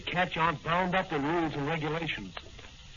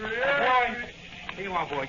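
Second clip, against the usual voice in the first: loud call down the street; 9 semitones higher, 5 dB louder, slower than usual. Acted. Dan hails the newsboy across the street, loud call. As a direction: hail the newsboy loudly.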